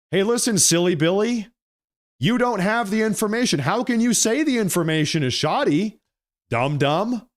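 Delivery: high, heated scolding; heated rant. Real, not acted. Ranting commentator scolding a senator, heated and high. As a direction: scold him like a fool, rising hot. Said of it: "Sorry, I'm getting heated".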